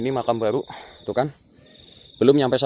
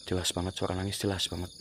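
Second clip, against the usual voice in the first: low, very soft aside; hushed report; 5 semitones lower, 13 dB quieter than usual. Real, not acted. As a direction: report it softly while listening. Listening to eerie crying. (bicara lirih)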